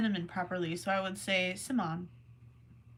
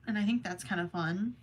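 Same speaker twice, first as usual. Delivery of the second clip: ordinary talk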